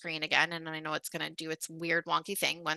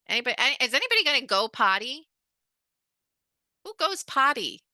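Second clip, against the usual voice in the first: higher, louder, fast singsong; mock baby talk; 6 semitones higher, 4 dB louder, faster than usual. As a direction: tease the class like toddlers. Teasing class in elder speak.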